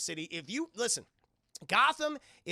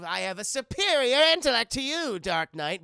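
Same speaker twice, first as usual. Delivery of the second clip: loud, higher sneering villain voice; villain taunt; 5 semitones higher, 10 dB louder than usual. Acted. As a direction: ham up the gloating villain. Riddler taunting Batman, acted.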